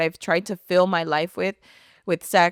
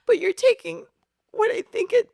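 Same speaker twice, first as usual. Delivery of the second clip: high, emotional pleading prayer; tearful plea; 11 semitones higher than usual. Retold or imitated. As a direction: plead with God, voice lifted close to tears. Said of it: "that's when I start crying".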